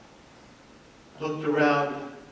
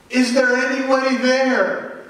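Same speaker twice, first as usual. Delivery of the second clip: higher, louder desperate call upward; 8 semitones higher, 5 dB louder than usual. Acted. Man hanging from a tree calls to the sky, pleading. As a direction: shout up to the sky for help.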